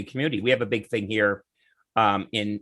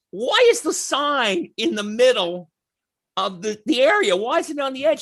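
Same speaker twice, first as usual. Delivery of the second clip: bewildered question; 10 semitones higher than usual. Retold or imitated. Passenger asks the bus driver about the sign, bewildered. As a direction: demand an explanation, pitched way up.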